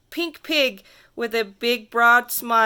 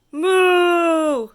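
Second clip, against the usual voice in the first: long, higher, louder moo; cow moo; 5 semitones higher, 5 dB louder than usual. Acted. The cow looks at the kid and moos, loud drawn-out moo. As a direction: moo out like a big cow in the road. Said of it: "and the cow says".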